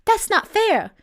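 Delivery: spoiled pouting protest. Acted. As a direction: sulk it out with a pout. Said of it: "She thrust out her lower lip".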